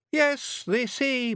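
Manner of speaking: Billy's voice. Acted. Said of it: "Billy began".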